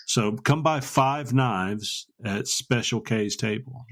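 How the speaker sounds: slow, deliberate plug for Special K's table; slow promo plug. Shown as a demonstration. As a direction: land each word like an ad tag. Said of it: "the way people can remember that".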